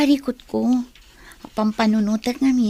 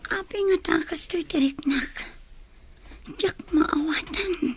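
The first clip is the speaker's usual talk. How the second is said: whispered thoughts; 5 semitones higher, 6 dB quieter than usual. Acted. Lani alone, puzzling over the boy, whispered. Lani's private musing about the boy.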